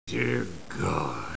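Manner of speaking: very soft, low exclamation; stunned, barely voiced; hushed dismay. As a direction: breathe it out under your breath. Stunned character line.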